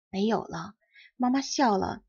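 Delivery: plain reading voice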